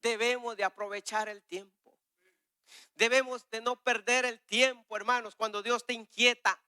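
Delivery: preacher urging the church not to waste time; fervent preaching. Real, not acted. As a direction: rouse the congregation. Preaching at full force.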